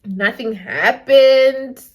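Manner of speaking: slow, drawn-out mock-earnest plea; imitated pleading boyfriend. Boyfriend assuring her mom nothing happened, slow mock-earnest plea. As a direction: stretch out each phrase in feigned sincerity. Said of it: "then he was like".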